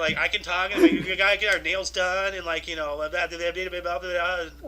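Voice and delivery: mock Valley Girl accent; hammed-up California Valley Girl talk. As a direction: put on a chatty Valley Girl voice. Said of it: "Do, like, Valley Girl".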